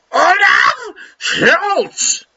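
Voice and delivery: put-on shouted, high German accent; mock German shout of the name. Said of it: "best German accent that I can do".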